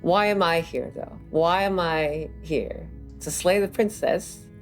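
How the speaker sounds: normal talking voice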